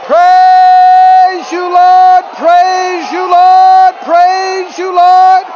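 loud, high cries of praise; shouted praise. Real, not acted. Preacher praising God before the church, loud worship cries. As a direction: lift it up to the Lord at full voice.

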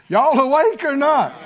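high, loud shout to the congregation; calling out. Real, not acted. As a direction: jolt the listeners awake.

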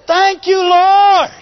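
high, loud shout of thanks; ringing, triumphant cry of gratitude; victorious shout. Shown as a demonstration. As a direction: shout praise with full confidence. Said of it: "We wanna shout".

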